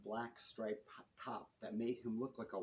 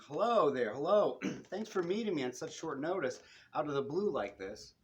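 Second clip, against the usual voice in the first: bright, much louder, higher gushing greeting; 4 semitones higher, 8 dB louder than usual. Acted. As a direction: gush over them like an eager old friend.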